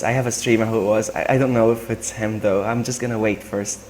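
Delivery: normal talking voice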